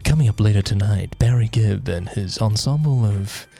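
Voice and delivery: low, slow announcer read; a hint of smooth late-night radio host. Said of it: "can we just get you to say".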